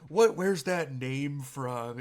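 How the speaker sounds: slow, loud prying question; mock nosy question. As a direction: drag it out, pushy and nosy. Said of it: "And he goes".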